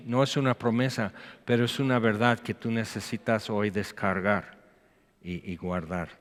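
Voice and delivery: usual speaking voice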